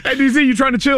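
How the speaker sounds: host laughing and mimicking a friend asking to chill, high; mock friend voice. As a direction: squeak it out like a hopeful kid, laughing in.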